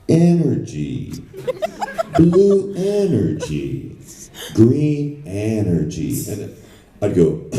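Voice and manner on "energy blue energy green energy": slow, drawn-out, overblown ad read; mock corporate pitch. Client demos his pitch to the voice actor, slow and hammy. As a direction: ham up the client's idea of a big sell. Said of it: "this is how I want it to sound".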